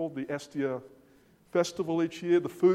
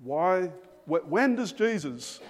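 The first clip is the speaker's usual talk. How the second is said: usual speaking voice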